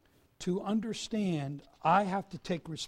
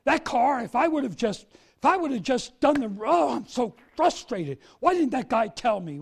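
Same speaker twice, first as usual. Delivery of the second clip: mock frustrated; 4 semitones higher, 6 dB louder than usual. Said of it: "Not just my frustration".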